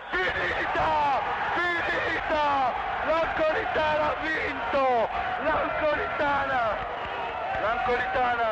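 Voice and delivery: high joyful shouts, slowed; jubilant shout. Real, not acted. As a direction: celebrate the win at full voice. Final whistle, commentator celebrates. (grido di gioia)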